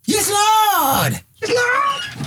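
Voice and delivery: very high mock-ecstatic cry. Host mimicking the singer in bliss, high mock shriek. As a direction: squeal it out in hammed-up rapture. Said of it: "Dat is [name] die klaarkomt".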